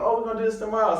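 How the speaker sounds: high, deflated echo; crestfallen surprise. Retold or imitated. Extra hearing the shoot is pushed, high and stunned. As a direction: repeat the bad news in disbelief. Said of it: "I'm thinking".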